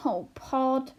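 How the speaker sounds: wailing. A narrator's reading. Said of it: "wailed Homily".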